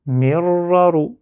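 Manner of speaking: slow "Mir, raru" joined with a held r; syllabes liées. Shown as a demonstration. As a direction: run "Mir" into "raru" on a long r. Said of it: "je bloque ici pour faire une syllabe". (« Mir, raru » lié lentement, r tenu)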